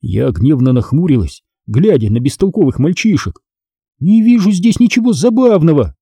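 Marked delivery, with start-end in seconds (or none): 4.0-6.0 high-pitched, strict reproof; strict, with raised pitch; stern. A narrator's reading. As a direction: reprimand sternly. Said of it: "строго сказала я".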